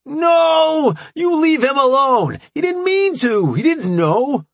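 high, loud staged scream; fake screaming. A narrator's reading. AJ staging a fight for the guards, screaming high. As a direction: scream it up to the ceiling. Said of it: "raised his voice to the ceiling and screamed".

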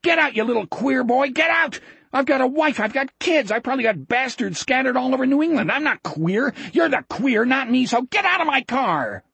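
high-pitched, enraged ranting; furious yelling. A narrator's reading. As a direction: rave at the top of the voice. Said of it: "Ferguson yelled".

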